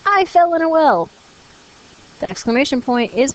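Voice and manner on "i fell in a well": high, louder call from down the well; child yelling for help from a well; shouting from the well. Acted. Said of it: "shouted Timmy".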